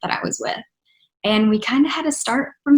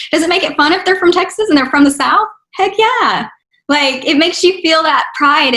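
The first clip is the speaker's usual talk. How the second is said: higher, louder, faster burst of pride; excited pride; 6 semitones higher, 5 dB louder than usual. Real, not acted. Talking about Texas artists, excited and proud. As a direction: cheer for home.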